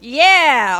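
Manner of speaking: loud. Retold or imitated. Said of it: "were always like".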